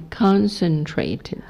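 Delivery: "concentrated" slowly, syllable by syllable; teacher's careful, drawn-out "concentrated"; modelled pronunciation. Shown as a demonstration. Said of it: "再次来".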